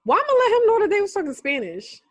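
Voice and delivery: high, loud disbelieving exclaim; shocked. Real, not acted. Friend's jail call plays in Spanish, loud shocked outburst. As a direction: react like the call just blindsided you.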